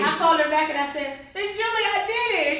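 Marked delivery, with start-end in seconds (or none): 1.3-2.6 high-pitched, thrilled good news; retold squeal of success; excited announcement. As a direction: burst out with proud news. Excitedly reporting her success by phone.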